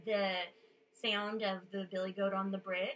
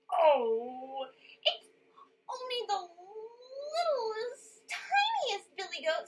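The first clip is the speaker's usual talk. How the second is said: high, louder tiny goat voice; very small voice; 11 semitones higher, 7 dB louder than usual. A narrator's reading. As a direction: squeak it out as the littlest goat. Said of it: "in his very small little voice".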